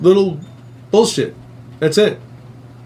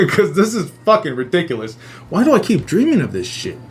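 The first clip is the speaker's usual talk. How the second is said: usual speaking voice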